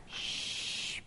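breathy donkey shh; donkey shush. Acted. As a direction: hush the baby like a donkey. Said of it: "brays donkey".

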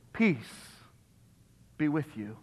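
soft, low and slow, a tender greeting; gentle Jesus greeting. Retold or imitated. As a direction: speak it gently like a blessing. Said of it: "what he says to them".

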